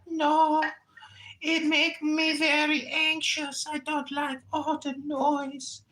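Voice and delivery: high, whiny immigrant tenant voice; mock tenant voice. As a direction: whine like a fretful tenant. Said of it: "And she's like".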